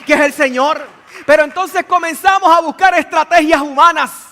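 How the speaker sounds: loud, high, driving pulpit voice; preaching at full force. Acted. Preacher rousing the congregation, full-force preaching. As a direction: build it up and hammer it home to the congregation.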